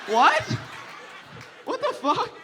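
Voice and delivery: very high, loud what the fuck, with a laugh; shocked outburst. Retold or imitated. Comic recalling the priest's request, shrieking disbelief.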